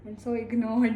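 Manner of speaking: dismayed outburst. Real, not acted. Dismayed at rotten rice jar.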